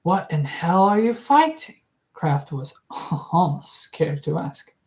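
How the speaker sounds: ordinary delivery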